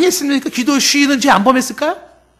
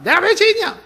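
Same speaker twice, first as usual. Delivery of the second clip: retold angry yell; 8 semitones higher than usual. Coworker protests being called a sinner, mock yell. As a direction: imitate someone yelling in protest. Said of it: "막 소리를 질렀다는 거 아니에요?".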